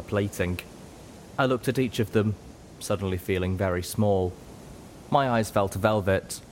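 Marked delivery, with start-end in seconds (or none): none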